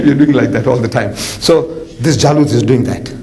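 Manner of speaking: usual speaking voice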